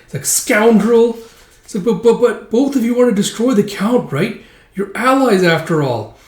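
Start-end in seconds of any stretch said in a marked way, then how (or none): 0.2-1.2 high, loud insult; squeaky indignation; acted outrage. Elf insults Guts. 1.8-6.2 high, stammered objection; high, flustered protest; protesting elf. Acted.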